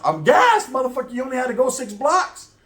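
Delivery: high-pitched, exasperated outburst; indignant protest. Retold or imitated. Arguing with homeboy over gas money, acted indignation. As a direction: protest as if being hustled by a friend.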